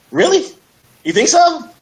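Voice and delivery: squeaky high "Really?", pitch leaping way up in disbelief; can't believe the compliment is true; disbelieving surprise. Retold or imitated. Brushing off a coworker's compliment, in high, surprised disbelief. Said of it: "Then you'll say".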